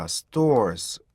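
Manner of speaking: "stores" stretched very slowly. Shown as a demonstration. Teacher slowly stretching out the word "stores". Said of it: "stores 이거는".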